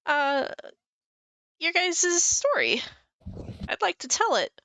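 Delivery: high, curious storyteller bard; goofy bard. Acted. Bard asking adventurers for their story, high eager voice.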